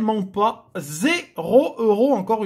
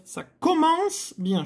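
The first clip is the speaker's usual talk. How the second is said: slow, higher sarcastic sing-song; ironic; 9 semitones higher, slower than usual. Acted. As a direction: say it meaning the opposite, drawn out. Ironic remark on bad numbers. (ironie traînante et plus aiguë)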